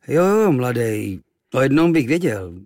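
lightly voiced old sea dog; lightly voiced sailor. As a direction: drawl it like a seasoned sailor, slowly.

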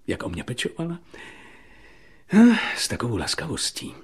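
soft, low, with long pauses; quietly smitten, word by word; tender reverie. Acted. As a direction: drift into fond recollection. Holmes dreamily recalls Irene's care.

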